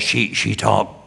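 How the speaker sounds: hint of the actress's rasp, half-voiced; mild gravelly impression; gravelly Tallulah voice. Shown as a demonstration. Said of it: "she talked in a gravelly voice".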